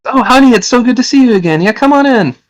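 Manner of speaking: very high-pitched woman's voice; welcoming woman. Acted. Woman inviting her supposed husband inside, high woman's voice. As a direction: gush a welcome in a high put-on voice. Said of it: "a voice from a woman say".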